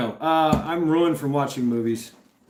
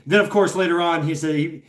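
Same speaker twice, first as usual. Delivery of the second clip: ordinary delivery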